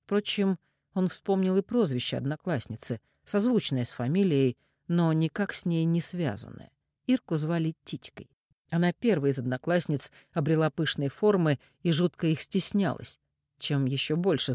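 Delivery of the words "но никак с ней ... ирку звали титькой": ordinary delivery, nothing marked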